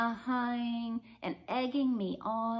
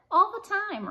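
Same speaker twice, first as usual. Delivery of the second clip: louder, much higher eager burst; eager boast; 12 semitones higher, 9 dB louder than usual. A narrator's reading. Heidi eagerly inflating her lie to Alice, loud and high. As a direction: blurt it out, overeager to impress.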